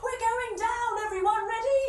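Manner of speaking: high, urgent call to everyone; excited warning. Acted. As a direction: call out high before the plunge. Calls out before going over waterfall.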